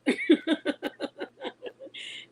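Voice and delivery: breathless laugh with no voice; silent wheezing laugh. Real, not acted. Mother laughing at her own joke. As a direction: laugh until no sound comes out.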